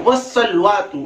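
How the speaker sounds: "वस्सलवातु" squeezed to "was-salwatu"; common mispronunciation. Shown as a demonstration. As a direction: drop the vowel after l in "वस्सलवातु". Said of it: "हमारा तलफ्फुज क्या है?".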